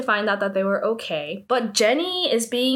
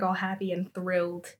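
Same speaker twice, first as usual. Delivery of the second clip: usual speaking voice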